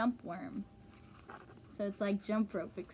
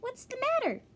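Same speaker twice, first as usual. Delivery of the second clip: much higher, lightly squeaky fellow's voice; a silly little creature asking kindly; high funny fellow; 3 dB louder than usual. Acted. The funny fellow asks crying Early Bird, high and silly.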